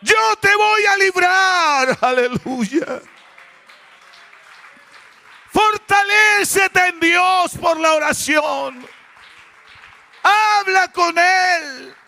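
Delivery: high, loud, drawn-out preacher's shout; fervent shouted exhortation; full-force preaching. Real, not acted. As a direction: proclaim it with long, ringing cries.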